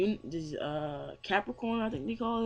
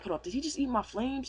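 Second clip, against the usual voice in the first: raised, incredulous question; mock astonishment; 6 semitones higher than usual. Hero seeing his flames eaten, mildly acted astonishment. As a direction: act lightly stunned, pitch lifted. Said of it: "Natsu's astounded".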